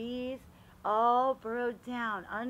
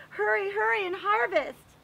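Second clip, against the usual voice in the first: higher, louder eager urging; urgent call; 10 semitones higher, 9 dB louder than usual. Acted. Storybook family rushing before the cold, excited call. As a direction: hurry the family along to harvest.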